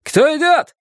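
very high-pitched call; high storybook shout across the water; shouted challenge. A narrator's reading. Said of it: "крикнул незнакомец".

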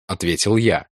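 ordinary delivery, nothing marked

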